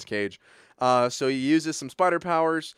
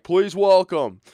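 loud, drawn-out mock announcer; slow, raised announcer call; ring announcer; 4 semitones higher, 8 dB louder, slower than usual. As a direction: introduce a fighter to the crowd. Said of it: "The announcer's like".

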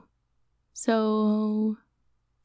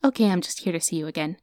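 rushed confession; faster than usual. Acted. Quick confession after being caught.

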